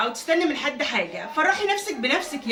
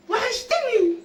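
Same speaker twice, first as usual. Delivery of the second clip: high, laughing, overjoyed welcome; real joy, rising high with a laugh; excited greeting; 10 semitones higher, slower than usual. Real, not acted. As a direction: gush over someone you've missed. Greeting a long-missed visitor.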